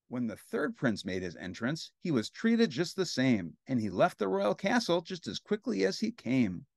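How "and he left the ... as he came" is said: ordinary delivery, nothing marked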